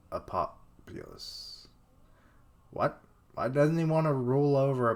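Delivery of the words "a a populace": much lower, whispered, slow; sinister hush. Acted. Villain revealing his true aim in a hushed whisper.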